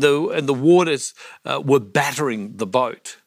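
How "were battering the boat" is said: normal talking voice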